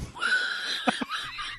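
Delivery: airy squealing laugh; breathy high laugh. Real, not acted. Laughing after song.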